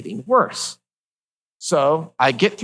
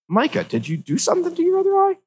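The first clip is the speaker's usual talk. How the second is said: surprised. Retold or imitated. Nurse alarmed by second eye.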